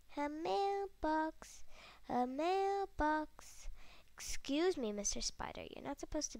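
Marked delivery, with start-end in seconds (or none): 0.1-1.6 slow drawn-out. Real, not acted. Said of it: "repeating slowly". 2.1-3.7 slow drawn-out. Real, not acted. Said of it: "repeating slowly".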